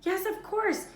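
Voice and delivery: high, loud panicked cry; panic, wringing her hands. A narrator's reading. Hermione cries out in panic, high loud cry. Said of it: "Hermione cried".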